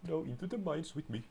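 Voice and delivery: jaunty adventurer call. Pretend. Cheerful announcement, voice shift.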